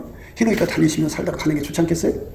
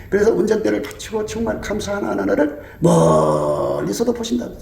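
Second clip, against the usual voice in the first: usual speaking voice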